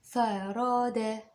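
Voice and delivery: "فرادة" drawn out very slowly. Shown as a demonstration. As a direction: spell out "فرادة" slowly for dictation. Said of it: "هقولها بشويش".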